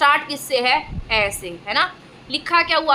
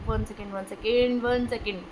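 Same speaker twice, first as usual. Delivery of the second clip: quiet aside; 5 semitones lower, 8 dB quieter than usual. Real, not acted. Teacher steps aside mid-lesson.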